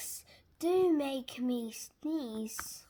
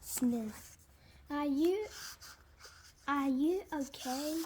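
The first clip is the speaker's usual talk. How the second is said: usual speaking voice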